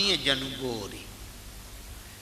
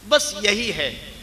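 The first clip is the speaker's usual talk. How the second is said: loud, higher, slowed preaching of the moral; fervent preaching; 5 semitones higher, 8 dB louder than usual. Acted. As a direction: drive the lesson home to the crowd. Preaches the moral at full force.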